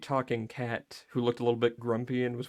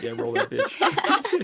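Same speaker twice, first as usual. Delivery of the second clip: hyped; much slower than usual. Acted. Hyped for the pitch.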